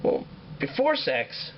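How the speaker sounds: a hint of a curious boy catching on; puzzled kid. Son questioning his father about before sex, puzzled rising. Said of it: "the kid says".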